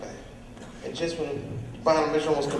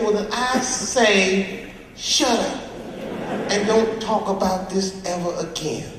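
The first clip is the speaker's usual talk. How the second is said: Iceberg ordering silence; 5 semitones higher, 3 dB louder than usual. Acted.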